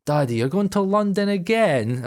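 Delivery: put-on high, slow child's question; imitating his daughters. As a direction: ask dad in a drawn-out little-girl voice. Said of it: "the girls were like".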